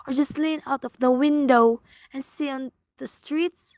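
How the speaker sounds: normal talking voice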